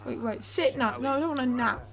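normal talking voice

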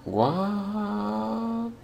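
soft, drawn-out high "what?"; high-pitched disbelief. Real, not acted. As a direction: let it climb high and hang there, quietly. Baffled reaction to the game.

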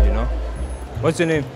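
normal talking voice

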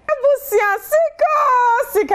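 very high, drawn-out call of sika; hyped call. Acted. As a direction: sing out sika like a promo jingle. Host hypes money call.

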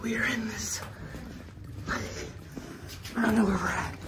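breathless from the climb; panting between words; out of breath. Real, not acted. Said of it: "I'm out of breath".

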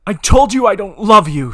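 loud, high frustrated scream; the same line now screamed, pitch up; scream in frustration. Shown as a demonstration. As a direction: belt it out at him. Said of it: "I really need to remember to scream that".

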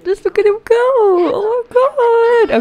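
very high, squealing near-cry; freaking out. Real, not acted. Player watching an infant crawl for the first time, squealing. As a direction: squeal like it is almost too cute to bear. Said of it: "I'm freaking out".

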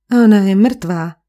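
loud exclamation of shock; cried out. A narrator's reading. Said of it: "vykřikl Fung".

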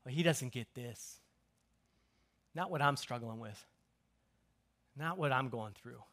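very quiet, low doubting murmur; doubtful reply. Acted. Congregation doubting that God gets it, in a low, hushed, skeptical voice. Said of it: "we're like".

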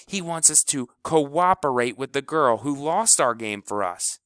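loud, high furious rant. Acted. Kleebo rants at the team about House, loud and furious. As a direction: rail at the whole team. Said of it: "Kleebo was a geyser".

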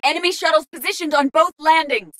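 high-pitched enemy alert; urgent combat callout. Acted. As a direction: alert the squad under pressure. Warning squad in combat.

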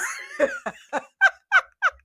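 a long squeal of laughter; high breathless cackle; high shrieking laugh. Real, not acted. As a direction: lose it completely. Cracking up after impression.

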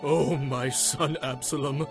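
spent, muffled lament of a father; weeping. A narrator's reading. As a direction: weep quietly for your dead son. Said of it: "kept on weeping".

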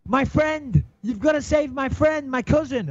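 high, frantic imitation of an old lady; mock panicked plea. Drowning old lady begging him to save her cousin, high mock panic. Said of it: "And she says".

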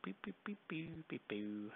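very soft little boops, dropping in pitch; idle boop-boop noises under the breath; playful booping. Real, not acted. Hums little noises while painting.

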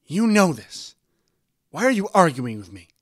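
high, very loud argumentative outburst; angry shout. A narrator's reading. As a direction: shout it at your husband in exasperation. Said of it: "Her mother shouted angrily".

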